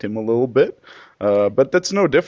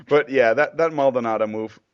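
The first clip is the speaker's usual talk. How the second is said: normal talking voice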